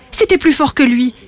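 usual speaking voice

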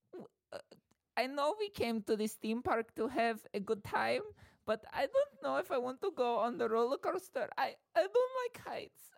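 very high, timid little creature voice; squeaky, nervous put-on accent, hesitant and pleading; Mort from Madagascar. Scared rider asking friends for frozen yogurt instead, timid squeaky voice. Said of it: "started doing Mort from Madagascar".

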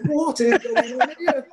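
high, slow baffled voice; mock disbelief, laughing; bewildered. Agent in car overhearing the call, baffled.

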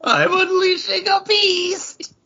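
high, squeaky joke voice with a laugh; silly squeaky voice. Pretend. Cracking up the co-host with a squeaky high joke voice. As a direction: play it as a goofy little gremlin. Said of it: "we're getting silly".

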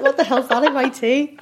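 laughing alarm. Real, not acted. Guest finds the tea bag in her tea, laughing alarm. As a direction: giggle through a startled question.

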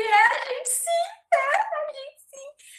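high, slow, sobbing voice; retold crying. Crying to the airline agent at the gate, high and sobbing. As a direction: wail it out through tears, dragging every word. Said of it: "Aí eu já comecei a chorar".

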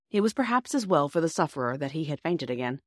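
ordinary delivery, nothing marked

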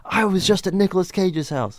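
high-pitched excited boast; excited phone brag. Retold or imitated. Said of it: "calling my friends back home".

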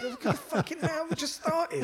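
high, loud, half-laughing protest; retelling the moment he saw the climb, shouting high; outraged high shout. Retold or imitated. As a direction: yell in mock horror at the huge mountain. Horrified at the climb.

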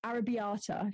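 "Arrabbiata" in an English accent. Shown as a demonstration. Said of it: "if you want it in an English accent".

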